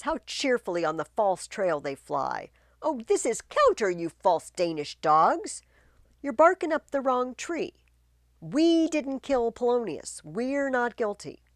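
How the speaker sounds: loud, high mocking rebuke; scornful queen. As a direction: sneer at the false dogs.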